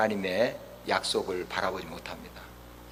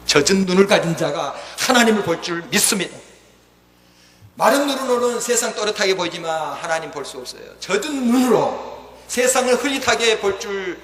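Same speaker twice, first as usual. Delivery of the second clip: raised, emphatic sermon delivery; full-force preaching; 9 semitones higher, 8 dB louder than usual. Real, not acted. Preacher urging the congregation to see God, full-force preaching. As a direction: press each point home to the congregation.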